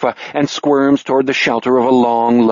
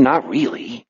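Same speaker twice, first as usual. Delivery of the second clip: plain reading voice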